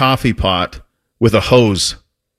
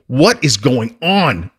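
slow, silent mouthing of the words; mouthed words; 2 semitones higher, much slower than usual. Retold or imitated. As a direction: mouth the words at a friend, slowly. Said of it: "just mouths to me".

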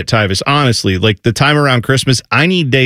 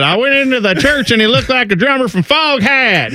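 higher-pitched comic retelling; quoting a comedian, pitched up; Larry the Cable Guy; 11 semitones higher than usual. Retold or imitated. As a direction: deliver it as a stand-up punchline. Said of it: "Was it Larry the Cable Guy? He said".

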